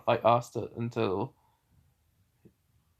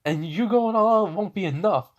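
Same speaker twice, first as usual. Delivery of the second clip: yelling; 6 semitones higher than usual. Acted. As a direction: shout her down as reckless. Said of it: "Captain Yami yells at her".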